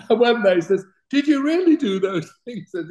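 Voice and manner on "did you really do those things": high, amazed voice of a starstruck official; awed clerk. Retold or imitated. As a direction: ask it like a clerk dazzled by a celebrity. Clerk in awe asking.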